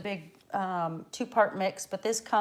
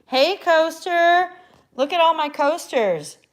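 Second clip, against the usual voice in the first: high, loud, drawn-out holler; excited shout; 10 semitones higher, 9 dB louder than usual. Real, not acted. Showing stream guests the coaster molds, excited shout. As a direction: call out loudly in delight.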